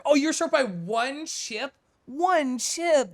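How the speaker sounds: high, drawn-out incredulous cry; slow, high-pitched outrage at a near miss; dismayed disbelief. Real, not acted. Dismay at viewer's narrow game loss.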